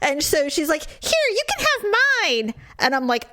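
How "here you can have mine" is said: high-pitched imitation of her offer; mock-generous stranger. Woman offering her basket to the husband, mock-sweet.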